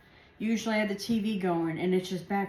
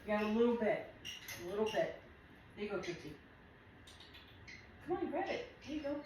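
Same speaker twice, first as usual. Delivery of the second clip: coaxing pet talk; 2 semitones higher, 10 dB quieter, faster than usual. Real, not acted. Coaxing the bird Tootsie.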